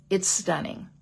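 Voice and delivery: ordinary delivery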